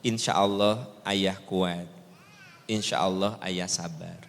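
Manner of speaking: soft, slow comfort to a husband; gently steady him; reassuring. Acted. Wife comforts her crying husband, soft and slow. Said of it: "Paling Ibu bilang gini".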